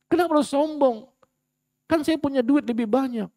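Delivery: high, mocking boastful kan saya chant; mock smug self-importance; arrogant boaster. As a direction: parody the proud man's excuses.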